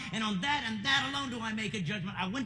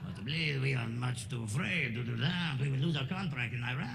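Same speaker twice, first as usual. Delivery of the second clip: mock French refusal; 6 semitones lower than usual. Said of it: "can you come help us out".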